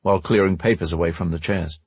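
plain reading voice

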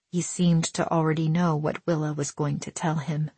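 plain reading voice

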